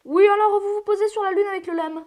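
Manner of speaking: high, loud official voice; mock official. NASA boss telling astronauts, high loud mock announcement.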